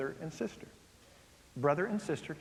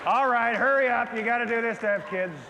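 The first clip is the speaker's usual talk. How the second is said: mock impatient wife; 5 semitones higher, 7 dB louder than usual. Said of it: "mocking a reluctant wife".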